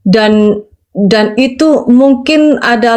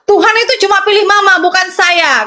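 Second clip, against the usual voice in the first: mildly acted higher-pitched complaint; upset son's protest; 6 semitones higher than usual. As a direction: imitate a resentful son protesting to mother. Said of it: "kata dia bilang".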